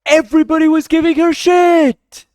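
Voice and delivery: yelling in outrage; indignant shout. Real, not acted. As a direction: shout it, fed up. Outraged at the backlash.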